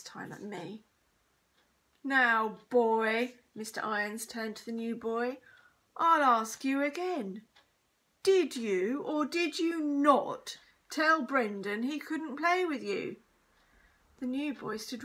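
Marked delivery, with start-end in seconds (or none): 2.0-3.4 loud, commanding address; stern teacher. A narrator's reading. Teacher turns on new boy, sternly. 6.0-13.2 loud, higher, slow questioning; stern interrogation. A narrator's reading. Teacher questions new boy, sternly.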